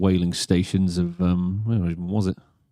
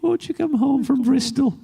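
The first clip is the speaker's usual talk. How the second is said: mum voice; slower than usual. Retold or imitated. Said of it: "she sounds exactly like that".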